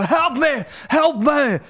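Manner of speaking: high, loud hammed-up cry for help; mock movie plea. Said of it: "you go".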